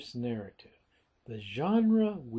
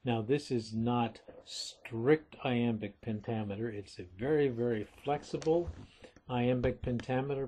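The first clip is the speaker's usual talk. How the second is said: ordinary talk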